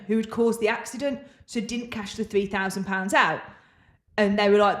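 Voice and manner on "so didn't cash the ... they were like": usual speaking voice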